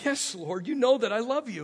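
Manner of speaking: insist, rising in force; insistent answer. Acted.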